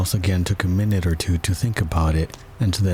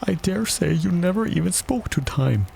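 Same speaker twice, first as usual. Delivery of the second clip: high-pitched, mildly scornful Hatter; contemptuous; 10 semitones higher than usual. A narrator's reading. Hatter to Alice about Time, contemptuous. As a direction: talk down to her in a high voice. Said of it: "tossing his head contemptuously".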